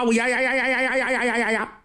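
high, very fast sputtering rage cry; furious yelling. Acted. Marshal sputters with rage at the insult, rapid high yammer. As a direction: choke on fury, rattling the syllables out. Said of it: "气得哇哇直叫唤".

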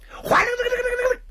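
rapid, very high rolling trill; imitated metal rattling. Storyteller voices the monk's rattling fork, high rapid trill. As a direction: trill it fast like jangling rings.

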